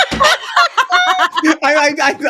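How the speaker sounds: high, loud laughing stammer; real laughter. Real, not acted. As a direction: crack up while trying to speak. Cracks up at own contradiction.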